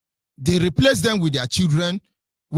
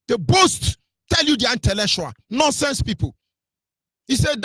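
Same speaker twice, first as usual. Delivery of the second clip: usual speaking voice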